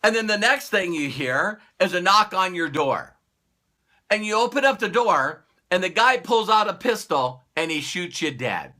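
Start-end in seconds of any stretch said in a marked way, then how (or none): none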